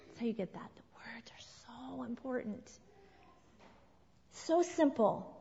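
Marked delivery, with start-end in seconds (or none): 0.1-2.8 hushed, breathy emphasis; whisper. Real, not acted. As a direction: drop to a whisper for weight. Unvoiced closing point.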